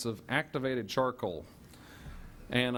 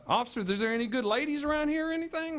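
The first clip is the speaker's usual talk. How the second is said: pitched way up, fast and goofy; high silly voice; 13 semitones higher, 3 dB louder, faster than usual. Retold or imitated. Friend asks police about ladies, squeaky and fast. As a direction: play the clueless friend chirping at the officer.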